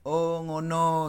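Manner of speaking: "Oh ngono" long, high and loud; drawn-out acknowledgement. Shown as a demonstration. Teacher shows students the Javanese acknowledgement, a drawn-out "Oh ngono". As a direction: stretch "Oh ngono" slowly, as if it just clicked. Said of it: "gitu ya kalau bahasa Jawanya".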